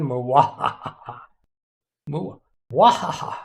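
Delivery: slow villain cackle attempt; evil laugh. Shown as a demonstration. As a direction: try out a panto villain laugh. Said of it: "I'm not even pronouncing that right".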